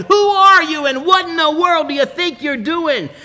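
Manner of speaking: pitched high, a sudden angry demand; angry shout. Retold or imitated. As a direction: yell at the kid who threw the brick. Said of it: "And he shouted".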